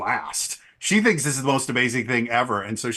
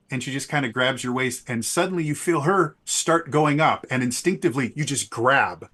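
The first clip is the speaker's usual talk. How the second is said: ordinary talk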